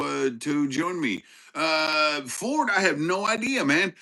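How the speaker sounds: ordinary talk, nothing marked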